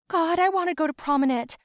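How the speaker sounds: high, loud and eager; excited declaration. Acted. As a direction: announce it with big excitement. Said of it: "I declared".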